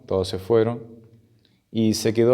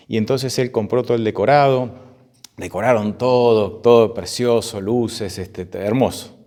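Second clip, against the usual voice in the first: ordinary delivery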